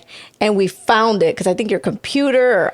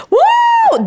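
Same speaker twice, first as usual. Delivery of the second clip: joyful whoop; 5 dB louder than usual. Real, not acted. Exclaiming at the story.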